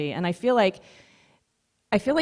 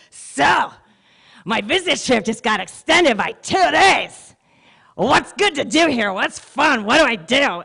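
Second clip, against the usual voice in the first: put-on drunk businessman; 7 semitones higher, 4 dB louder than usual.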